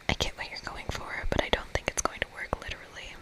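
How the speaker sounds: ordinary delivery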